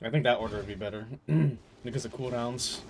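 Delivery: ordinary talk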